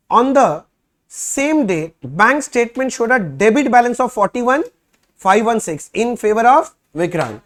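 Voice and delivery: slow, loud, word-by-word reading of the problem; reading the question aloud. Shown as a demonstration. Teacher reading the problem to students, slow and loud. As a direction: dictate each phrase slowly for the class.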